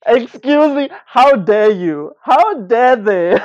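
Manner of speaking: loud, high, drawn-out indignation; mock outrage. Friend hearing of a rude name question, hammed-up outrage. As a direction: act scandalised, stretching out every word.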